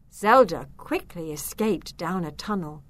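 usual speaking voice

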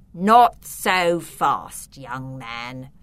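very slow menace, loud then dropping low; sinister voice. A narrator's reading. Filbert catches the escaping children, sinister. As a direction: draw it out like a villain. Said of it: "a sinister voice said".

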